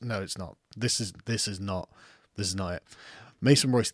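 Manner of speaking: usual speaking voice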